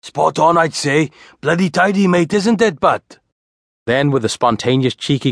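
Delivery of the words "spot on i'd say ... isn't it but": high, lilting Welsh voice; chirpy broad Welsh banter, pitched well up; broad Welsh accent. A narrator's reading. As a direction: give approval in a sing-song Welsh accent. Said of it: "in his broad Welsh accent".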